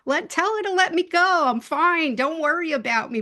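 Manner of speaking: imitating a cat's high, reassuring little voice; high, loud-edged voice of a small pet; high-pitched little cat. Cat telling mediums to pass word to owner, high-pitched. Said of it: "It was like a high-pitched voice".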